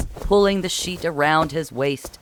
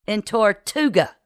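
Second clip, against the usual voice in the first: high, bitter accusation; raised, resentful interjection; 7 semitones higher than usual. A narrator's reading. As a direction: spit it out bitterly. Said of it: "Kit supplied bitterly".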